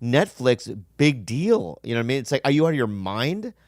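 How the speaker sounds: usual speaking voice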